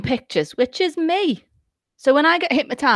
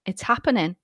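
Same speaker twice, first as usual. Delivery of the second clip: ordinary talk, nothing marked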